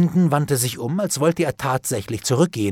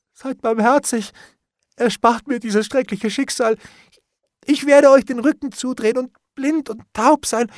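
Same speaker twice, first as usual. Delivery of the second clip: high, wailing, tearful panic; terrified begging; 12 semitones higher, 6 dB louder than usual. A narrator's reading. Bailiff threatened with hanging, begs in wailing terror. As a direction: beg loudly for mercy, pitch way up.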